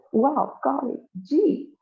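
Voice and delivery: very high, wide-eyed exclaiming; gushing excitement. Acted. Isaac Interjection steps up, exclaiming in high excitement. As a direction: pop each word up high with delight.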